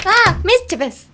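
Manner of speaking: loud, high "Ah", then "mischievous" blurted out; mock frustrated exclamation. Shown as a demonstration. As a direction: exclaim as if annoyed at a mistake. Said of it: "you make a mistake and you're like".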